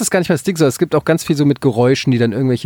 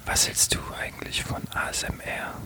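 whispering; 13 dB quieter than usual. Pretend. Teasing ASMR whisper question.

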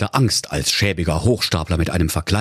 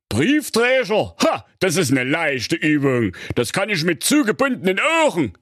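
proud wizard; 8 semitones higher than usual. A narrator's reading. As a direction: scoff at how easy it is. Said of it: "Der Zauberer sagte stolz".

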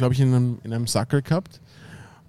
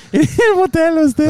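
laughing; 7 dB louder than usual. Real, not acted. Laughing while telling hotel story.

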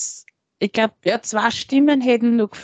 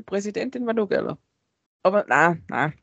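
ordinary talk

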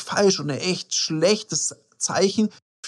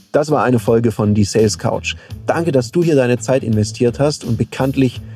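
louder, steady announcer delivery; podcast outro read; 3 semitones lower, 4 dB louder than usual. Acted. Host closes the episode for listeners, announcer-style outro read. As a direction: read the sign-off firmly and evenly.

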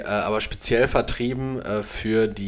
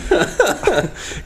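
high laugh; 10 semitones higher than usual. Real, not acted. Laughs at Google Plus.

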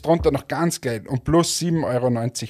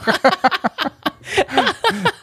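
high laugh; 14 semitones higher than usual. Real, not acted. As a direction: burst of high-pitched laughter. Still laughing.

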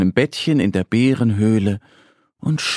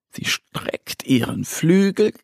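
plain reading voice

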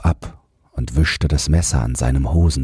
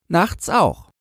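put-on high child's voice, falling at the end; answering boy; 14 semitones higher than usual. A narrator's reading. Boy confirms his night watch, firm answer.

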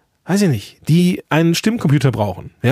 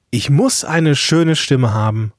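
normal talking voice

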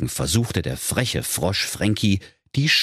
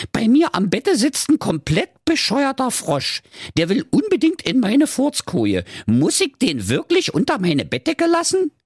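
high-pitched whining daughter; put-upon high princess; Carola Knackwurst. Acted. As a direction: complain to your father in a very high voice. Voices Carola complaining to father.

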